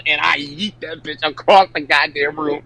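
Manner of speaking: usual speaking voice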